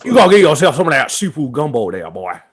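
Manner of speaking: mock Southern New Orleans swagger; New Orleans accent. Selling seafood gumbo to a friend in a mock New Orleans accent. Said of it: "I was going for a New Orleans accent".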